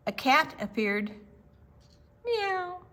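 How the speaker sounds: plain reading voice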